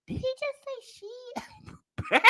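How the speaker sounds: high, hushed, slow disbelieving question; stunned disbelief. Retold or imitated. Mother to her son after the cashier cussed, hushed high shock. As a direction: squeak it softly, aghast, as an aside.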